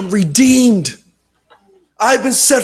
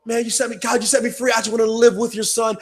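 ordinary talk, nothing marked

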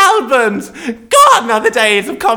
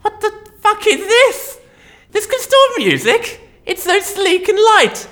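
astonished; 9 semitones higher than usual. Acted. Astonished at the iPod.

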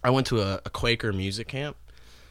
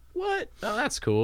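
surprised delight. Retold or imitated. Retold finding notebooks.

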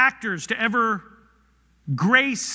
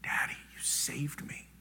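breathy unvoiced whisper, much softer; hushed whisper; 8 semitones lower, 18 dB quieter than usual. Shown as a demonstration. Believers calling to God as Father, whispered. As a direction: whisper it like a grateful child. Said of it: "cry out with every scrap".

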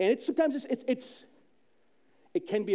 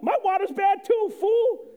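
higher, louder, playful yell at a friend; mock shout; 13 semitones higher, 6 dB louder than usual. To a friend in the water line, mock shout. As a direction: bark it out teasing a buddy. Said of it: "I'm like".

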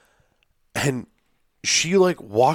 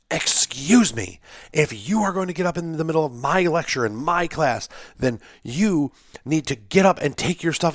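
mock stern professor; 6 semitones higher than usual. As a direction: play the prissy professor putting a student in his place. Strict professor voiced scolding student.

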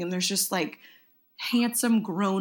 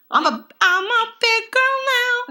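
put-on much higher, proud little-girl voice; 5 dB louder than usual. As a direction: puff up like a kid bragging about growing up. Proud child voice.

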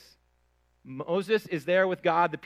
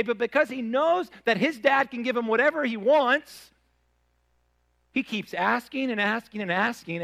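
excited retelling; 7 semitones higher, 5 dB louder than usual. Real, not acted. Excited story of travel with kids.

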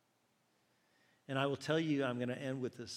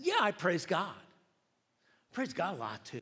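loud, higher-pitched insistence; frustrated retort; 6 semitones higher, 8 dB louder than usual. Retold or imitated. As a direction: push back with rising exasperation. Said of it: "I'm getting frustrated, more and more frustrated".

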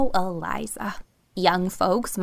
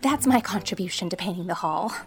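a touch out of breath from hurrying; lightly acted breathlessness; gasping. A narrator's reading. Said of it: "she gasped".

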